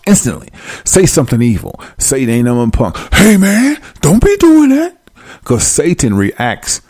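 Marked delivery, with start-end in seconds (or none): 3.1-5.0 high-pitched, louder imitation of a protester; mock alarmed protest. Mimicking someone who hears Satan being insulted, mock alarmed protest. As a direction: imitate someone urgently warning a friend off. Acted objection to talk about Satan.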